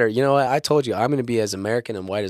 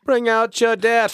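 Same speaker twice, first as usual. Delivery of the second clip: movie plague cart call; 10 semitones higher, slower than usual. Retold or imitated. Echoing a friend's film quote in a high slow call. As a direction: bellow it slowly down a plague street. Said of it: "I love that scene".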